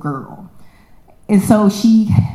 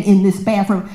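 usual speaking voice

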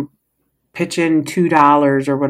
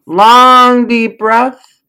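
louder, higher, emphatic; teacher's mantra; 7 semitones higher, 7 dB louder, much slower than usual. Retold or imitated. Quoting her first teacher's mantra, emphatic and lifted. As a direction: proclaim the mantra with full breath. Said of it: "As my first teacher said".

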